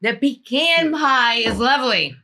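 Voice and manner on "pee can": "pecan" said as pee-CAN, flat A as in can; pee-CAN pronunciation. Real, not acted. Defending your way of saying pie's name, "pecan" as pee-CAN. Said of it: "You gotta say pecan".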